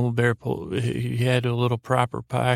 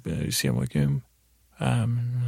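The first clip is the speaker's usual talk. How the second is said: Mumble Bear. Acted. Bear replies after the hug, mumbling. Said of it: "I'm mumbling to Mumble Bear".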